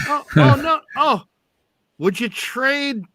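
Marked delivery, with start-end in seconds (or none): 0.1-1.2 laughing. Real, not acted. Host laughing at trade question.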